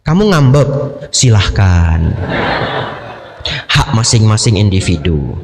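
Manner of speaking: spouse parroting the monk's line back; mock indifference. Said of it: "kata Bante Abijato".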